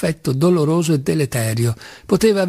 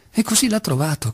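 plain reading voice

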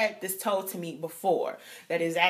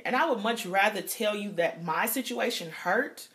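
normal talking voice

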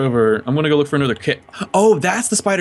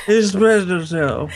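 mock unenthusiastic; 4 semitones higher, slower than usual. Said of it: "It's just the way you say it".

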